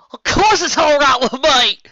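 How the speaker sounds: high, loud, slow cry of joy; overjoyed. Acted. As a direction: burst out in delight. Overjoyed character in fanfic reading.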